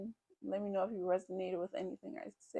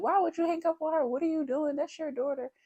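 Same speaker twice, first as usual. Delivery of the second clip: high, raised-voice imitation of a telling-off; indignant retold scolding; 8 semitones higher, 4 dB louder than usual. Bonus mom scolding dad for hanging up on his daughter, indignant. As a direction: challenge him in mock outrage, pitched up.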